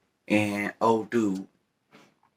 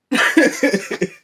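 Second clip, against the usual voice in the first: loud, high-pitched laugh; short, loud high laugh; 8 dB louder than usual. Real, not acted. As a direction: burst out laughing at your own remark. Laughing at own remark about proportions.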